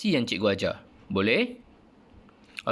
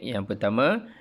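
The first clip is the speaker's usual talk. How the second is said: ordinary delivery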